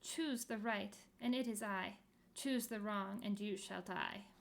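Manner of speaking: usual speaking voice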